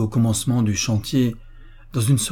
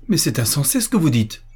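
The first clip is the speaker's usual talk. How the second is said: higher-pitched, mildly outraged objection; indignant protest; 5 semitones higher than usual. Acted. Fiancée to officer who is leaving her, indignant protest. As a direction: protest in disbelief.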